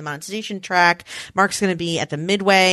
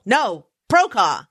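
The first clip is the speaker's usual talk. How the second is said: ordinary talk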